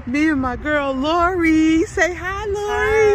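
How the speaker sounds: high, loud, thrilled introduction; excited. Real, not acted. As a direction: gush about your bestie being here. Excitedly introducing visiting best friend.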